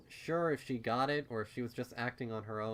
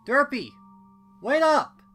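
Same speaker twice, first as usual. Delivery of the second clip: much higher, loud shout across a distance; calling out; 17 dB louder than usual. A narrator's reading. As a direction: yell to stop her walking away. Calling after departing friend.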